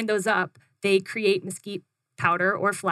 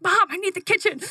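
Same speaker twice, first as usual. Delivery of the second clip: higher and louder urgent call; urgent excited call; 11 semitones higher, 4 dB louder than usual. Retold or imitated. Running home to her mom, an urgent excited call. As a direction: burst in needing the kitchen now.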